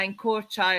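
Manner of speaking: usual speaking voice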